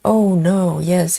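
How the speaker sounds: ordinary delivery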